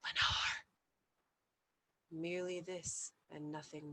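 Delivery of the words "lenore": echoing whisper. A narrator's reading. An echo whispers the name back to the speaker. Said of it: "an echo murmured back the word".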